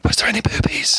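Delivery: cheeky whispered aside; hushed, mischievous whisper. Acted. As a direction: whisper it like a naughty secret. Cheeky question, then whispered repeat.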